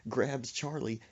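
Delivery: usual speaking voice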